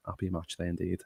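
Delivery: ordinary talk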